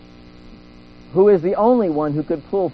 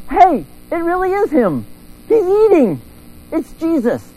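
much higher, loud excited cry; excited recognition; 12 semitones higher, 3 dB louder than usual. Retold or imitated. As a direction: squeal it out in delighted discovery. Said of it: "they go".

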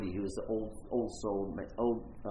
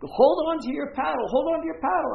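retold rafting guide yelling over the rapids, much higher and louder; urgent shouted warning from the raft; guide shouting; 8 dB louder than usual. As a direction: bellow the order at a man overboard. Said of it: "the guide is like".

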